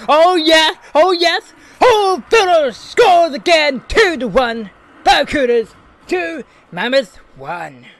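high, loud goal call like a commentator; sports commentator. Acted. Gamer doing play-by-play after his own goal. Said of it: "my commentating voice is very on point".

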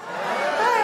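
high, loud gushing hello; high excited welcome to the crowd; excited greeting. Real, not acted. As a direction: burst on thrilled to be back. Comic bounding on stage, thrilled.

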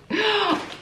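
sudden high shocked gasp; sharp gasp. Real, not acted. Vlogger gasping after nearly breaking a candle. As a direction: gasp in real alarm.

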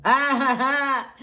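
high, loud burst of laughter. Real, not acted. Bursts out laughing over the cards.

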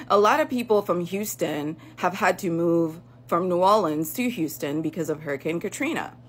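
ordinary talk

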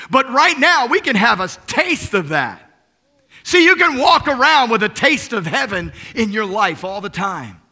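loud, high-pitched sermon delivery; impassioned preaching. Real, not acted. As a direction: preach it out with full force. Preaches at full force.

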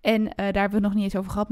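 usual speaking voice

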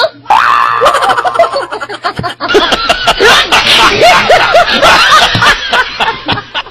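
helpless laughing fit; long laughing fit. Real, not acted.

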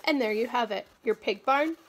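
ordinary delivery

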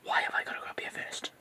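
hushed whisper. Real, not acted. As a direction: whisper so nobody hears. Whispering hidden in the attic.

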